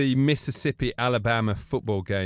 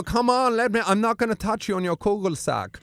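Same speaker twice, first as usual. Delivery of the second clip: much higher coaxing Austrian voice; Bruno voice; 6 semitones higher than usual. Retold or imitated. Bruno coaxing the wrestlers, in a high coaxing voice. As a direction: wheedle like a flirty Austrian. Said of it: "I go".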